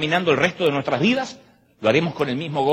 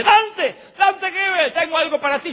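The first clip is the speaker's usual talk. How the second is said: much higher, calling voice of an eager brother; eager church brother; 13 semitones higher than usual. Retold or imitated. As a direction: call out his name from across the crowd. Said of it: "me dice".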